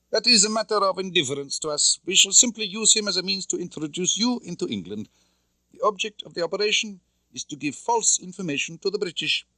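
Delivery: high, cold commanding officer voice; stern Soviet colonel. A narrator's reading. Colonel Klebb briefs Tatiana on her mission, coldly commanding. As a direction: lay out orders like an interrogator.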